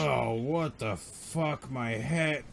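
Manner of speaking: very soft, slow dazed groan; acted pained mumble, drawn out and weak; hurt man groaning. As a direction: play injured, dragging the words out quietly. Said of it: "The guy on the ground is like".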